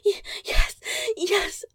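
high, loud cries of pleasure; loud moaning. Acted. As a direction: cry out in ecstasy.

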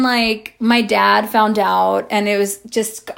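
normal talking voice

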